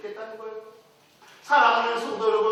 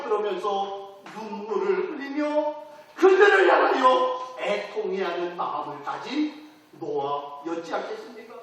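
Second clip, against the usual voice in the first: ordinary talk, nothing marked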